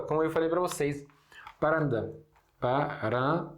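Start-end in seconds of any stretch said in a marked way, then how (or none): 1.6-3.5 "Paranda" with a p sound where Portuguese has v; careful demo of "Paranda"; Arabic paranda. Shown as a demonstration. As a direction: paranda for varanda. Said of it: "temos a palavra paranda".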